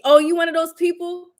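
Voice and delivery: normal talking voice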